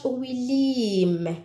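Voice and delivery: normal talking voice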